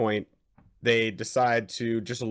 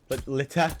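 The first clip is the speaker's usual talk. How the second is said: r-less Boston ending on "litter"; mock Boston accent. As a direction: try "litter" as Boston "littah". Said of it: "A H instead of E R".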